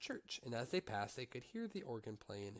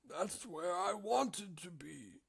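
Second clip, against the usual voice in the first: put-on old drover; 7 semitones higher, much slower than usual.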